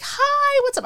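excited greeting. Retold or imitated. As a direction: greet a friend with glee. Friend Kim greets excitedly.

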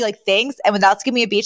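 ordinary talk

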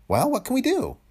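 high-pitched voice for a worried colleague; camera expert's voice. A narrator's reading. Cameraman asking Tony about trouble, high character voice. Peters answers Quaid in dialogue.

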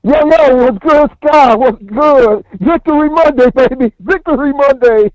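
loud, high-pitched victory holler; excited shouting. Real, not acted. Caller greets the host on Victory Monday, shouting with excitement. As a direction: belt out a pumped-up greeting, overloading the mic. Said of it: "you're clipping on me".

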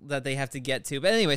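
usual speaking voice

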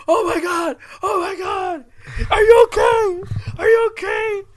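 high, loud cries of alarm; mock panic. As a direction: panic like kids over a fallen friend. Retold kids panicking over fallen boy.

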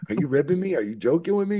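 can't believe he's serious; incredulous. Retold or imitated. Disbelief at Kurt's deafness.

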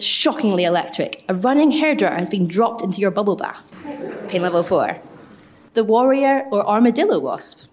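normal talking voice

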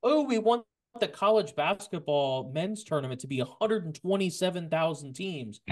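high-pitched mock-eager demands; mocking fans. Podcaster imitating fans demanding ever bigger playoffs, high mocking exaggeration.

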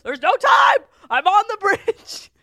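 hammed-up frantic shout; panicked shout. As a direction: yell it like you're stuck mid-bridge. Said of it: "And I was like".

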